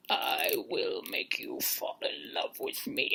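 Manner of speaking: very low, slow, soft voice; mock seductress. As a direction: intone it like a spooky love spell. Imitates seductive contestant.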